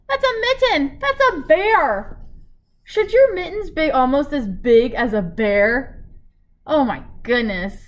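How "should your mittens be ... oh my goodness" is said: plain reading voice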